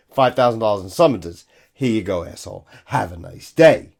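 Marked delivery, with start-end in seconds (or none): 1.7-3.9 mock-polite sign-off. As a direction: hand over the ticket with a lower, smug courtesy. Handing summons to imagined protester.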